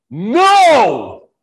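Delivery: a sudden loud shouted "No!"; yelling no. Shown as a demonstration. Pastor showing how he refuses doubts, loud yell. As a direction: bark out the refusal at full voice. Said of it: "And I started yelling now".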